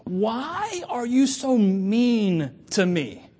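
lightly acted hurt whine to the stained glass window; whiny complaint. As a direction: plead with God like a wounded child. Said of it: "and he goes".

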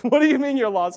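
loud, high disbelieving question; incredulous friend. Retold or imitated. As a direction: throw it back at him in disbelief. Quoting friend Tom's reaction.

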